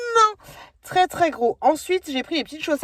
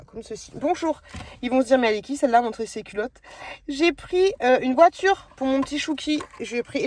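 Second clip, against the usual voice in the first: ordinary delivery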